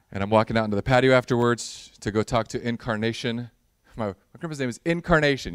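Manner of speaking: ordinary delivery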